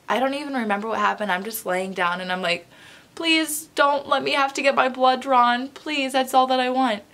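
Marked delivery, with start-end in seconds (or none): 3.1-7.0 acted begging in a higher voice; retold high-pitched plea; pleading. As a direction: plead like a scared patient, pitched up. Begging not to get blood drawn.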